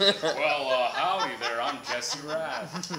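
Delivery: loud cowboy John Wayne drawl, laughing, very slow on the last words. Retold or imitated. Co-star mocking Jesse as John Wayne, laughing cowboy drawl. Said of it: "I sound like John Wayne".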